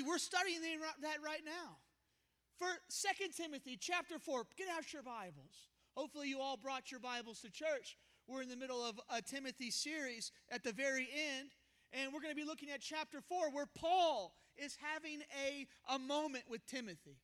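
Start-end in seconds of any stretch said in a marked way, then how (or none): none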